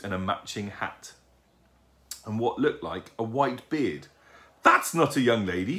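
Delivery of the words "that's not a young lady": mild excited shriek, louder. A narrator's reading. Owlet spotting the figure below, shrieking. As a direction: exclaim in amazement as the owlet. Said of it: "shrieked Plop".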